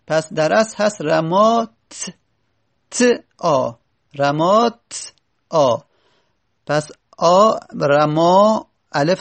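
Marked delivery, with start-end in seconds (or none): 1.0-3.7 "رمات" stopped on ت, long gap, then آ apart; unvowelled ت. Shown as a demonstration. Teacher showing the original silent-vowel ت, "رمات" then separate آ. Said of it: "تای تأنیس اصلش ساکنه". 4.2-5.8 slow "رمات", stop, then detached آ; unvowelled ت. Shown as a demonstration. Teacher showing two vowelless letters meet, slow split "رمات آ". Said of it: "تای تأنیس اصلش ساکنه".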